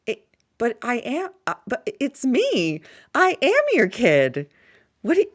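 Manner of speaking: a hint of a whiny eight-year-old; imitating her son. Mother imitating her son pushing back, lightly childish voice. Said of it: "He's like".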